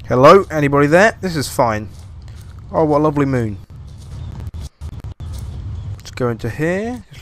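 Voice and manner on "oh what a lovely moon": normal talking voice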